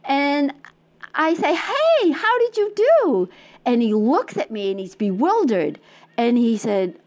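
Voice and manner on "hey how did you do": high bright call across the crowd. Retold or imitated. Runner calling to her husband ahead after finishing, high call. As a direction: call ahead to him, much higher. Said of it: "And I call to him".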